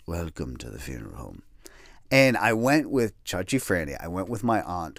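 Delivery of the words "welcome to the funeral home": low and much softer; somber funeral greeting. Retold or imitated. Funeral home staff greeting visitors, low and much softer. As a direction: hush it down like a mourner. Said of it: "funeral home is just so somber".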